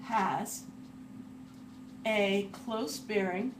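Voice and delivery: words spaced out while writing; broken into chunks with long gaps; slow dictation. Real, not acted. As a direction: say it slowly, as if dictating. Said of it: "Let me write that down real quick".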